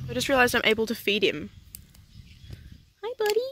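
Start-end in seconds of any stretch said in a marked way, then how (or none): 3.0-3.4 sweet animal talk. Real, not acted. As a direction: coo at the little bird. Greeting a wild bird.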